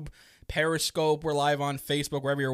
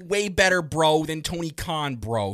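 loud, cocky bro know-it-all rant; hammed-up loud bro ranting about Tony Khan; mock bro fan; 7 semitones higher, 11 dB louder, slower than usual. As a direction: puff up like a fan who thinks he could book better.

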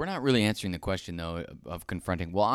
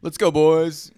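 loud bro cheer, higher; frat bro cheer; 8 semitones higher, 12 dB louder, slower than usual. Real, not acted. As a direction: shout it like a toast. Toast shout.

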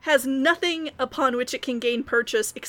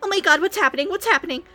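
higher, frantic, panicky female voice; panicked feminine voice; 7 semitones higher, 4 dB louder, much faster than usual. Acted. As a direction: squeal it out in a panic. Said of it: "a feminine voice".

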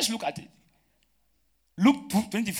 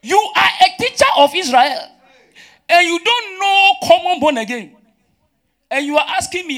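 high, loud, indignant preaching; incredulous scolding; 10 semitones higher, 8 dB louder than usual. Acted. Preacher scolds Nicodemus as teacher of Israel, incredulous. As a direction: hammer the accusation home in disbelief.

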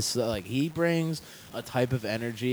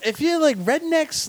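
high excited voice; 4 dB louder than usual. Retold or imitated. Building into redneck voice.

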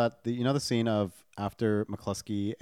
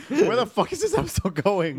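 higher, laughing disbelief; amused, wondering aloud; laughing exasperation; 10 semitones higher, 4 dB louder than usual. Real, not acted.

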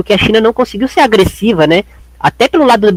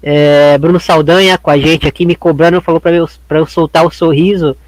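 usual speaking voice

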